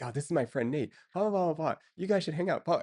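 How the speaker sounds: lightly hammed-up imitation of an excited friend; over-the-top intro. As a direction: gush like an eager matchmaker. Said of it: "she, like, goes over the top".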